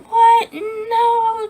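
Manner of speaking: high, loud mock cry of disbelief; re-enacted wail. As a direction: act out a long wailed denial. Re-enacting her shocked grief.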